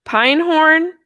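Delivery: high loud proud "Pinehorn"; excited announcement. Real, not acted. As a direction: present it with flair.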